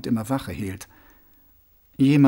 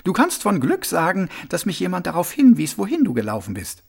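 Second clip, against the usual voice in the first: lightly voiced woman, higher and reproving; Rosi, brisk young woman; 6 semitones higher than usual. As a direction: scold him lightly in a higher voice. Rosi scolds Mumm.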